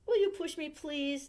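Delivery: put-on high begging little girl. A narrator's reading. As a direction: plead up high like a small child. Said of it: "Nikki begged".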